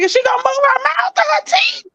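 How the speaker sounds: very high-pitched, incredulous retold question; mock incredulous. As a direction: squeal it in exaggerated disbelief. Retelling her incredulous reaction to robotic talking.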